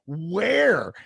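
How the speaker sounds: loud, high, incredulous demand; one word, loud and astonished; retold shocked question. Retold shocked question about python.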